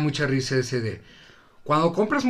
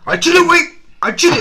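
high, loud blurted outburst; mock shout; 12 semitones higher, 6 dB louder, faster than usual. Friend mimicking his buddy's outburst, loud and high. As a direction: belt it out like a sudden confession. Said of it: "Dijiste, así".

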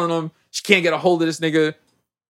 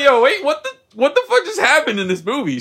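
high, incredulous outburst; retold disbelief; 10 semitones higher than usual. Viewer retells his shock at a movie scene, incredulous. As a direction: replay your bafflement with the pitch way up.

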